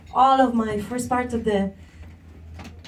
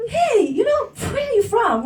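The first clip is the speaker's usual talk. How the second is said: much higher, sweet and friendly; nice friendly asking; 10 semitones higher than usual. Retold or imitated. Mimicking the kind way Felix asks where she's from. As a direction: ask it kindly, like Felix does. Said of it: "it's a nice way".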